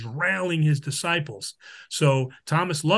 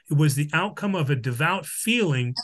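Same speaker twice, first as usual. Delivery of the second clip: usual speaking voice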